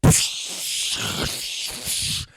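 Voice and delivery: breathy unvoiced gibberish noises; mock panic sounds; imitated panic noises. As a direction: make wordless freak-out noises. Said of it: "making noises like".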